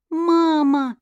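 put-on high, bright child calling Mama; child's eager cry. A narrator's reading. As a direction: cry out like a little girl. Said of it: "горячо воскликнула девочка".

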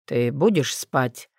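slow, low and drowsy; half asleep, dragging words; sleepy voice. Acted. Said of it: "сонным голосом".

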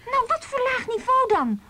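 incredulous question. Acted. Pressing friend about husband.

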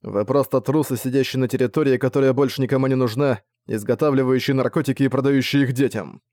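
ordinary delivery, nothing marked

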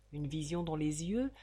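ordinary delivery

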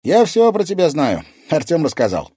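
put-on high, loud teenage boy; ringing, self-assured boy; boyish character voice. A narrator's reading. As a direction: chirp it confidently like a lad. Boy's character voice in dialogue.

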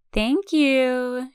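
high sing-song thank you. A narrator's reading. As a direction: lilt it teasingly. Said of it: "she sing-songs".

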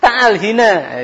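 high, loud call of "Ta'al hina" with hina for huna. Retold or imitated. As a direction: yell "Ta'al hina" like a street boss calling workers.

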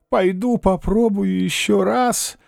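put-on old man resolving. A narrator's reading. Old man's muttered resolve.

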